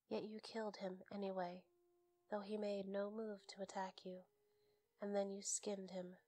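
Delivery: ordinary delivery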